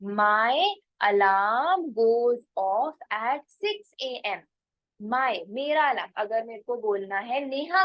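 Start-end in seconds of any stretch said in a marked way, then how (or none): 0.0-4.4 very slow, word by word; teacher dictating an example sentence; slow model sentence. Shown as a demonstration. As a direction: spell it out slowly for learners. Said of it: "तो मैं क्या बोलूंगी?".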